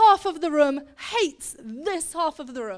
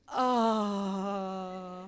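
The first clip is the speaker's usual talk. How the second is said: long, low, sustained "aah"; drawn-out aah; 7 semitones lower, 5 dB quieter than usual. Acted. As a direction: hold a low, sighing aah. Repeated reaction to shepherd's plight.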